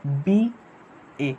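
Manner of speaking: "बीए" stretched slowly, syllable by syllable; drawn-out pronunciation. Shown as a demonstration. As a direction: model "बीए" very slowly for the class. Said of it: "इसको pronounce करोगे".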